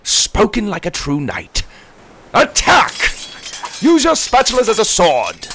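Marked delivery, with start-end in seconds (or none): 2.3-5.5 rally the knights into battle; battle cry shouting. Acted.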